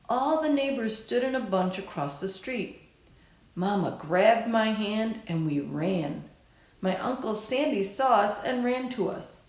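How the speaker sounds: ordinary delivery, nothing marked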